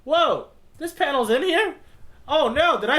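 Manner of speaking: high, loud surprised whoa. Real, not acted. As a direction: caught off guard by the panel. Surprised by the panel.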